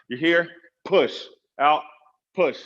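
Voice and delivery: "push" hit hard with a forceful drop; slow count where each "push" is driven out with effort; punchy push cue. Shown as a demonstration. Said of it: "You can hear the inflection in my voice every time I push out".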